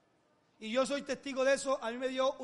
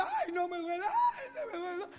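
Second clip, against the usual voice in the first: put-on higher, whimpering child voice with a laugh; mock crying child; 8 semitones higher than usual. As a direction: play the child crying from toothache. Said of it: "Y yo". (niño que llora de broma, voz aguda)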